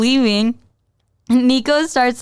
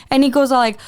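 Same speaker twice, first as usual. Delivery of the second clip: ordinary talk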